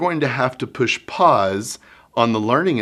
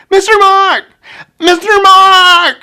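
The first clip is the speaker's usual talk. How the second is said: high, much louder drawn-out yell across the room; screaming child; 14 dB louder than usual. Retold or imitated. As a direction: shout for the teacher from afar. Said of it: "and screaming and screaming".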